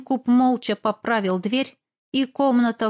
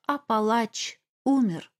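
usual speaking voice

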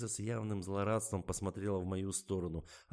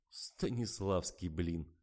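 usual speaking voice

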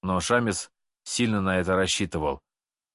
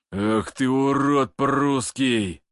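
roaring; 4 semitones higher, 3 dB louder, much slower than usual. Acted. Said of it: "взревел Алексей Орлов".

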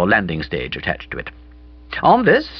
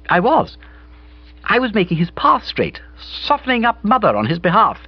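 put-on higher, brighter girl's voice; Bobby's voice; 13 semitones higher than usual. A narrator's reading. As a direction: play Bobby, lively and breezy. Bobby answers.

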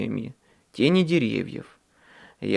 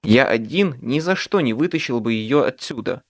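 plain reading voice

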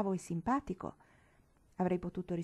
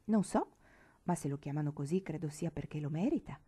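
plain reading voice

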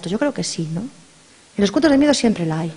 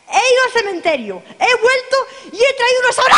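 triumphant; 11 semitones higher than usual. Acted. Hostess boasts to her friends, high triumphant shout. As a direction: crow about it proudly.

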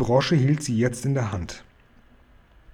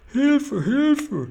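very high panicked squeal; cry for help; 13 semitones higher than usual. A narrator's reading. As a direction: squeal for help far above your usual pitch. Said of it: "schrie der Fleischfetzenfresser".

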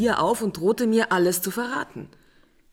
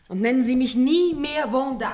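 scharfe Zurechtweisung; 6 semitones higher, 4 dB louder than usual. Acted. Sie verbietet ihm den Namen.

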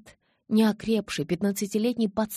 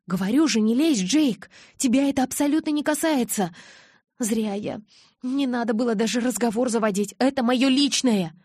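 heated retort; 5 semitones higher, 6 dB louder than usual. Acted. Bella snaps back at Jacob.

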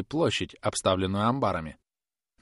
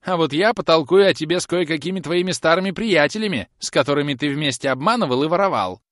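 put-on higher, loud threatening old woman; scolding threat; 8 semitones higher, 7 dB louder than usual. Old woman threatens the groom with his old pals, scolding loudly. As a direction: scold with raised pitch and volume.

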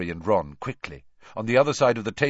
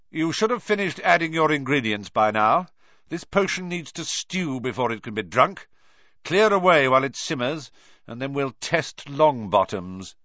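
stern teacher; 7 semitones higher, 4 dB louder than usual. Acted. Teacher ordering the class.

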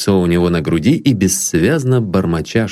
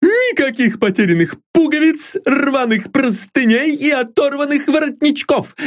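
put-on very high, firm, proud old servant; a maid boasting of her washing; high, resolute servant voice; determined maid. A narrator's reading.

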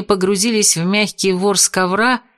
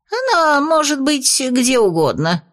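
высокий голос беса; 7 semitones higher than usual. Acted.

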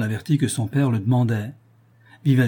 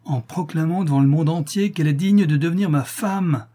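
higher, louder defiant declaration; exclaiming boldly; 6 semitones higher, 3 dB louder than usual. A narrator's reading. Son declares his love to his father, bold outcry. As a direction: proclaim it with courage. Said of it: "s'écria Vivaldi".

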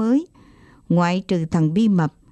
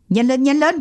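high, urgent command; pressing, raised-pitch order; near shout; 6 semitones higher, 4 dB louder than usual. A narrator's reading. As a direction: hurry someone along, almost yelling. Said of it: "Ba nói gần như quát".

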